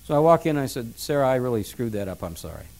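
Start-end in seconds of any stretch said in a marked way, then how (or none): 1.0-2.7 low, soft apology, sinking away at the end; subdued apology. Retold or imitated. Father apologizing to his daughter on her bed, subdued and quiet. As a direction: own up quietly. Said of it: "now I'm crying".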